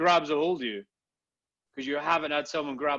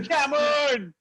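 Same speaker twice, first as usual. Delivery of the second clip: hooligan shout; 9 semitones higher than usual. Retold or imitated. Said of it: "he's like".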